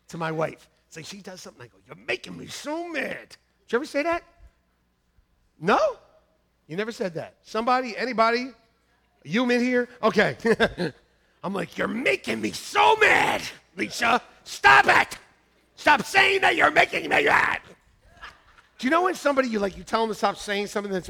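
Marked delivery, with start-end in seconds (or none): 1.9-3.3 understated retold complaint, softer; restrained exasperation. Retelling his complaint to his wife. 11.8-17.6 hammed-up furious yell, high and slow; exasperated shouting. Acting out anger at his wife.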